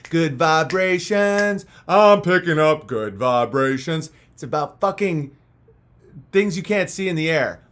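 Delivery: normal talking voice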